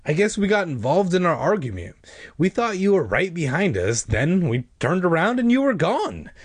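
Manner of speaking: put-on high, animated girl character; cousin Sari, exasperated. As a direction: explain with lightly acted exasperation. Voices cousin Sari explaining.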